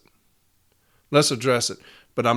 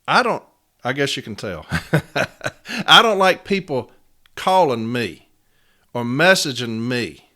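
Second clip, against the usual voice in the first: ordinary talk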